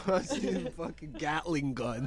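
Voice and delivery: laughing loudly while talking. Real, not acted. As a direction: say it through loud laughter. Laughing while mocking friend.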